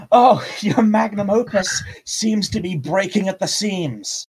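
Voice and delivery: high-pitched, mocking call to the enemy; yelled taunt. Player's character taunting the enemy over his failing creation, yelled. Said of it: "And I yell out".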